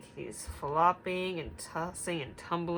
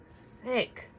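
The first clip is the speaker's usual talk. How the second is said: whispered sick; 5 semitones higher than usual. Acted. Refrain ending whispered.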